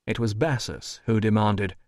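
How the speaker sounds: plain reading voice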